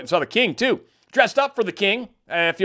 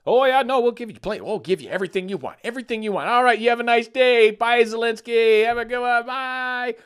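mock fake promises; 9 semitones higher than usual. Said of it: "So a lot of talky talk".

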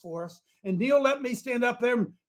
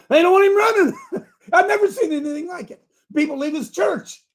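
laughing; 10 semitones higher, 5 dB louder than usual. Real, not acted. Laughing disbelief.

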